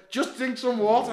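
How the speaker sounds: high, loud mock urging; retold scream. Mate trying to get water into him, shouting in imitation. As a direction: yell the order as an exasperated mate. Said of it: "but he's screaming at me".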